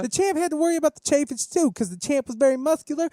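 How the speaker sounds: high lisping Mike Tyson impression; squeaky, lispy boxer voice. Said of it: "I don't really sound like him".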